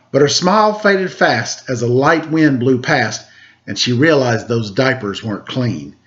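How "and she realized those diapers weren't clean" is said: usual speaking voice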